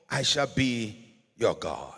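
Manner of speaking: usual speaking voice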